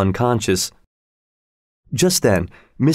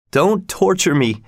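higher, pained plea; crying out; 6 semitones higher than usual. A narrator's reading. Lover begs dying woman to stop, crying out. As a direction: cry out in mild torment. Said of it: "cried Heathcliff".

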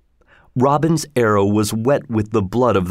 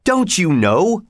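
much higher and slow; accusing; 7 semitones higher, slower than usual. A narrator's reading. Second forester accuses Robin, much higher and slow. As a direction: draw it out like an accusation. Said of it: "said another".

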